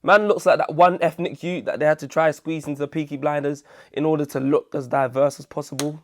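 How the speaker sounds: usual speaking voice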